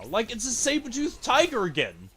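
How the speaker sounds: high, loud exasperated shouts; frustrated yelling. Real, not acted. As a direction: rage at the game. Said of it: "because I'm so mad".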